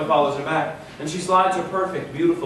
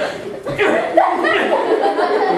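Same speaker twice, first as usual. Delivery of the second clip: high squeaky strained laugh; high wincing giggle; pained laugh; 4 dB louder than usual. Shown as a demonstration. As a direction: laugh through the pain. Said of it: "She's like, she's like".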